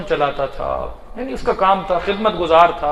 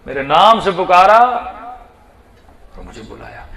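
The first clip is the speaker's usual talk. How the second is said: slow calling out; 4 semitones higher, 8 dB louder, slower than usual. Acted. Dead father calling his son.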